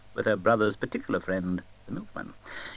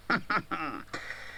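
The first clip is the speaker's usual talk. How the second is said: jolly laugh; 6 semitones higher, 7 dB quieter than usual. A narrator's reading. Fezziwig laughs.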